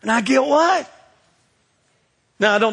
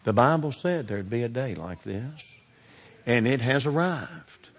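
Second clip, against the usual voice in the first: calm dad; 6 semitones lower, 6 dB quieter than usual. Retold or imitated. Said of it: "my dad will walk out ... and go".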